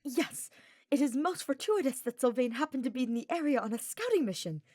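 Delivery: beaming. A narrator's reading. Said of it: "Ferdinand declared, beaming".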